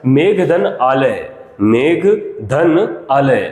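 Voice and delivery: slow syllable split. Shown as a demonstration. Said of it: "देखो मेघालय".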